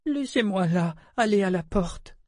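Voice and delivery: high, weary pleading voice; exhausted young woman. A narrator's reading. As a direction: plead like someone at the end of her strength. Exhausted Keki pleads weakly.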